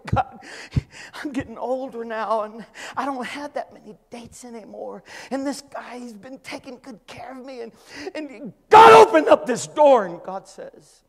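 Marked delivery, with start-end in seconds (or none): 0.1-10.1 working up to a shout at God; mock prayer. A believer pleading with God at the door, rising to a shout. Pastor voices his own prayer.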